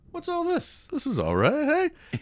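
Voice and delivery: high-pitched, pleased surprise; acted cheeky interest. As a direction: greet the newcomers in a high, playful lilt. Acts his unafraid character greeting women.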